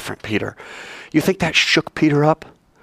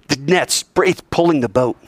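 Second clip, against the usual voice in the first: usual speaking voice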